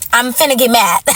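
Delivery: normal talking voice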